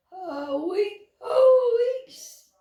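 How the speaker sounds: high straining squeak that cracks; squeaky attempt. Owlet copies the mouse's squeak, straining high.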